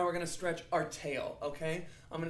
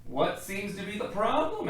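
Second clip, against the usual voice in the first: doctor voice; 4 semitones higher, 5 dB louder than usual. Acted. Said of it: "asked the doctor".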